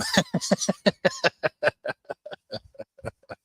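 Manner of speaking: high burst of laughter. Real, not acted. Laughing at own dog voice.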